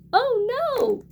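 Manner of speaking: high, loud squeal of dismay; a kid shrieking at a nasty find; Franny shouting in disgust at smelly socks. A narrator's reading. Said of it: "Franny clears her bin and shouts".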